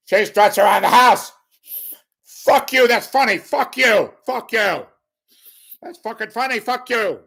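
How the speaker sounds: shouted, very high Tony Montana impression; Scarface. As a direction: yell it like a hammed-up gangster comic heckling back. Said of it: "What would it sound like?".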